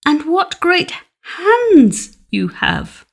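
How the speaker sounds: put-on high, slow, wide-eyed girl; a small girl's high slow wonder; wondering little girl. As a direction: draw it out in awe. Red Cap questions the wolf.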